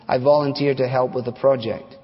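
normal talking voice

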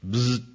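mock wrong-answer buzz; imitated buzzer. Preacher rejecting the friend's answer, imitated buzzer. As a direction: buzz like a pinball machine.

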